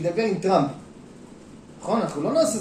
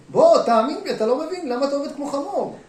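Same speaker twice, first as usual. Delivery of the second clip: higher, louder urging as God; 6 semitones higher, 6 dB louder than usual. Acted. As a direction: press him to trust, voiced as God. God urges him to trust. (אלוהים מפציר, בקול רם וגבוה)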